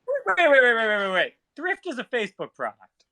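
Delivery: normal talking voice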